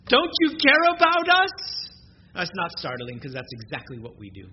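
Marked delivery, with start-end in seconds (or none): 0.1-1.9 high, loud and slow, a frightened plea; desperate cry. Retold or imitated. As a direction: cry out to Jesus in the storm. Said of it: "it's kind of startling what they say to him".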